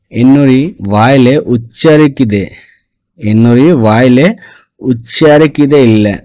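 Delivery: "এন্নরি ওয়াইলে উচ্চারিকিদে" spelled out slowly, each syllable clear; slow Tamil demo. Shown as a demonstration. Teacher to Bengali students, slow careful Tamil model. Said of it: "সে কথাটাকে তামিল ভাষায় কীভাবে বলবে".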